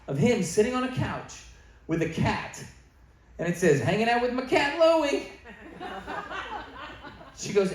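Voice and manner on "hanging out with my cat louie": chirpy post reading. Retold or imitated. Reading Andy's Facebook post.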